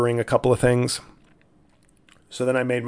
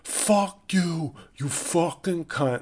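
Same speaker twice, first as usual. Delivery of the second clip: put-on high-pitched, held-back yell in a New York accent; mock New York scream; 6 semitones higher, much slower than usual. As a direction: drag out a New York insult, high, without real volume. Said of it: "the guy was just screaming out".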